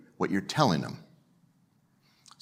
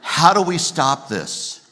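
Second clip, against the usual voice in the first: ordinary talk, nothing marked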